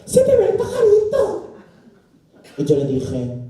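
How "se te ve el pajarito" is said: very high, loud shrill lady; old lady voice. Retold or imitated. [name] imitating the lady on the street, very high and loud. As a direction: screech it like a scandalised old woman. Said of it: "me dijo una señora".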